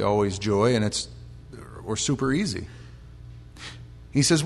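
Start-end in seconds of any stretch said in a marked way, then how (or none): none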